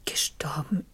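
stunned, breathless whispered disbelief; shocked whisper. Acted. Madame hears from the director that Turgot died, shocked whisper. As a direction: barely voice the words, knocked off balance.

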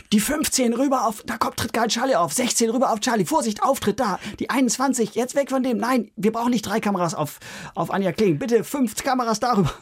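hoch, laut gerufene Regieanweisungen; Lars Jessen brüllt. Retold or imitated. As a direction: ruf Kamerabefehle wie ein gehetzter Regisseur. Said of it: "Lars Jessen und brüllt". (der Regisseur brüllt ins Mikrofon, hoch und hektisch)

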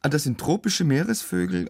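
ordinary talk

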